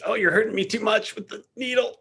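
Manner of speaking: high-pitched imitation of a squirming woman; mock complaint. Commentator voices the actress squirming in the scene, mock pained complaint. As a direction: put on a high, pained little voice.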